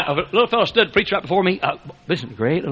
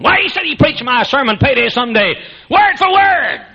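high, loud outraged cry; rising to a shout on word for word; furious retelling; 11 semitones higher, 6 dB louder than usual. Retold or imitated. Doctor Lee to his wife, outraged, shouting. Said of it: "Doctor Lee was furious".

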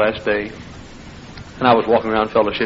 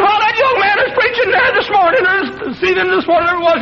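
put-on high, thin old man voice; frail old man recalling; squeaky old man voice; 4 dB louder, faster than usual. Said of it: "in a squeaky voice".

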